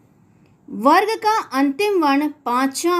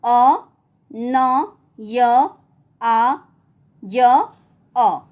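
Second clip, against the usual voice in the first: each sound of अन्याय said apart with pauses; slow spelling out; 2 semitones lower, 4 dB louder, much slower than usual. Shown as a demonstration. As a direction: break अन्याय into sounds one by one. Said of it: "उसका वर्ण विच्छेद होगा". (अन्याय के वर्ण अलग-अलग धीरे बोलें)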